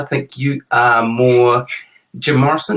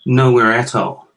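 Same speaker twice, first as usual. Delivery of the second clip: ordinary delivery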